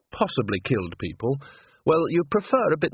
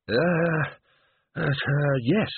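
strained hesitation; 4 semitones higher, much slower than usual. A narrator's reading. Overheated man struggles to reply.

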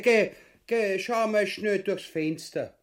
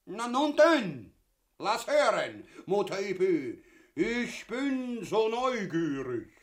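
high, slow, drawn-out coaxing; mock-genteel eager singsong; affected curiosity; 5 semitones higher, slower than usual. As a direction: draw out the words with falling swoops.

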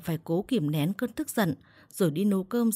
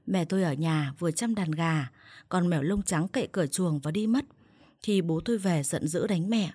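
ordinary delivery, nothing marked

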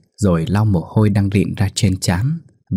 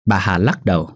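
ordinary delivery

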